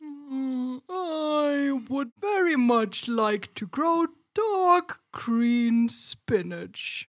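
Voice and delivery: very slow, high, drawn-out voice; slow tortoise. A narrator's reading. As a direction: stretch every word like a sleepy tortoise. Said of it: "then said slowly".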